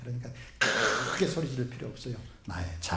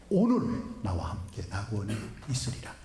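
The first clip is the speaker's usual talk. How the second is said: 오늘 lifted at the start; stress on 오늘; 4 semitones lower than usual. Shown as a demonstration. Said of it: "이 오늘이 중요한 거예요". (오늘을 강조하며 낮고 느린 선언)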